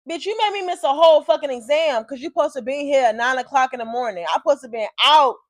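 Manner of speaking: indignant rant. Retold or imitated. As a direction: lay into the officer over the missed exam. Rant at officer over missed exam.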